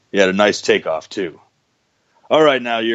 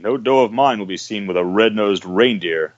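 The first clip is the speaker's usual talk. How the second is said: usual speaking voice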